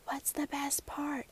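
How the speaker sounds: soft secretive whisper, slow. A narrator's reading. Grandmother answering the girl's secret, whispered. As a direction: whisper back playing along. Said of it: "in a voice reflective of such a secret".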